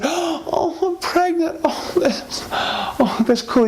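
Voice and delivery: high breathy gasping delight; stunned happy wife. Acted. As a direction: squeal it out, half laughing. Wife hears she's pregnant.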